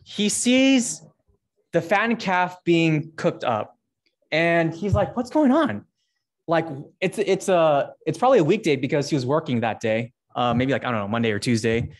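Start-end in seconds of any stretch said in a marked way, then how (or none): 5.2-5.8 startled high question from the brother; surprised brother. Acted. Older brother stumbling onto the party, surprised. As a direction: catch him off guard, pitch up.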